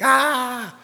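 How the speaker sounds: short high-pitched "ah" cry; mock child's wail. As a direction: imitate a hurt kid crying out. Hurt son's cry, imitated.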